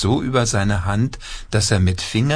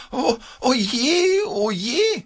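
very high, slow wailing puppet; dismayed puppet wail; slower than usual. A narrator's reading.

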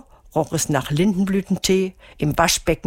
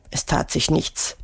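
usual speaking voice

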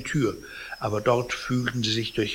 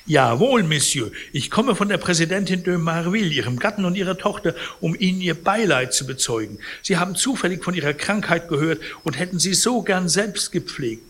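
high, fast, smooth persuading voice; oily lawyer selling an offer to the patient; unctuous lawyer; 5 semitones higher than usual. Acted. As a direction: sweet-talk the sick old man quickly.

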